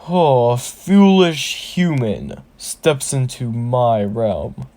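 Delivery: slow, theatrical orc boss sneer; drawn-out villain greeting, falling away at the end; orc shaman. Acted. Orc boss addressing a human intruder, slow and menacing. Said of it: "then the Orc Shaman sees Deku".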